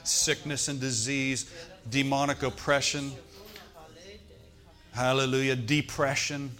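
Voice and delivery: loud, driving prayer breaking curses; forceful pastoral prayer; fervent prayer. Real, not acted. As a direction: pray with authority over the people. Prayer of release over congregation.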